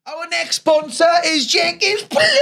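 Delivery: high, shouty radio-ad pitch; hammed-up ad read. Podcast host selling a plumbing sponsor, hammed-up ad shout. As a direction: belt out the sponsor's name, stretching it out.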